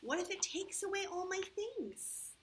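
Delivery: put-on a mild fretful little boy; worried child. The boy imagines the problem getting him, mildly fearful. Boy's fears in story.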